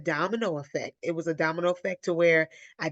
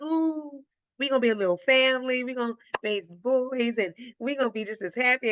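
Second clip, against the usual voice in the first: higher, dreamy excited retelling; giddy excitement; 6 semitones higher than usual. Retold or imitated. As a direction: gush like a girl in love, mildly. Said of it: "I was all excited".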